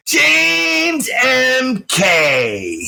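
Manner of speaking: long, drawn-out high holler; calling out. Retold or imitated. Old acquaintance spotting him across the bar, drawn-out high call. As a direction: stretch each word out like a hail across a room. Tom Brady calls out across venue.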